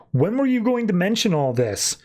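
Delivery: high-pitched incredulous demand; retold affronted questioning; acted indignation. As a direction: confront them, voice pitched well up. Acted teammate confronts Manta about bomb.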